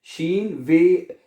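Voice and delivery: शीन वे drawn out very slowly; slow demo. Shown as a demonstration. Teacher builds the word for students, slow. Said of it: "W को वे पढ़ा जाता है".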